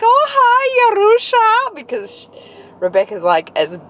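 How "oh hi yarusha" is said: very high, loud mock-Aussie squeal; hammed-up Aussie greeting, pitched right up; broad Aussie impression. Imitating postal worker Rebecca greeting her, exaggerated high Aussie accent. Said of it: "Aussie as they come".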